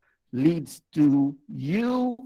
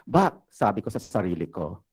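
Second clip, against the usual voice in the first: ordinary talk, nothing marked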